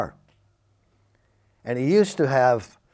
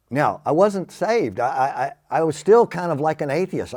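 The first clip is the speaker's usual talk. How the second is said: usual speaking voice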